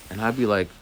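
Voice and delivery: usual speaking voice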